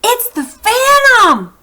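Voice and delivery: high, loud, slow scream; acted scream of discovery; fisherman screams. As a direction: scream out the name. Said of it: "he screamed".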